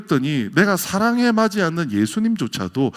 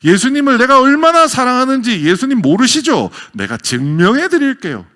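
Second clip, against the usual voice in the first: eager Martha; 8 semitones higher, 8 dB louder than usual. Acted. Martha eager to prove her love.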